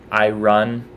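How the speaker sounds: "I run" for iron, r before the vowel; literal spelling pronunciation. Shown as a demonstration. Testing the spelling aloud, iron as "I run". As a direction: say iron loud as "eye-run". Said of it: "doesn't make any sense either".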